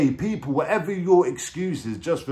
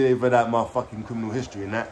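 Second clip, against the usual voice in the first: usual speaking voice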